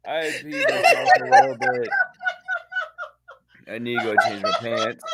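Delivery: very high, squeaky laughing speech; helpless laughter. Real, not acted. Drew has laughed until he leaked, helpless squeal. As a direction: squeeze the words out through laughter. Cracking up after impression.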